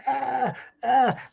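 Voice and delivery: high, strained mock cry of childbirth; two high, drawn-out pain groans; pained labor wail. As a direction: cry out like someone giving birth. Acts woman in labor.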